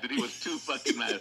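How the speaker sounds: usual speaking voice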